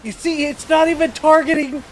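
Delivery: high, loud panicked outcry; panicked shouting. Real, not acted. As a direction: yell it in alarm. Wild Pokemon attacking the player.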